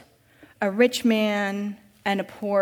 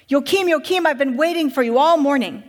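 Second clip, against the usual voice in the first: higher, louder, eagerly calling his name; 4 semitones higher, 6 dB louder than usual. Acted. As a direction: call out to him as if you've been waiting all morning. Master runs out, calling eagerly.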